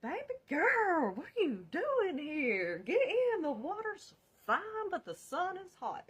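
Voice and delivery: high, loud, dreamy stoned voice; tripping boyfriend. Retold or imitated. Boyfriend high on acid greeting her, high dreamy stoned voice. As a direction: sing it out like you're lost at the beach. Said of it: "he's like".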